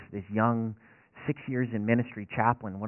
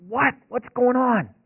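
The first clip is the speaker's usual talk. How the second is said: much higher, louder worried wife voice; alarmed wife; 13 semitones higher, 9 dB louder, much slower than usual. Retold or imitated. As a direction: play the startled wife asking what happened.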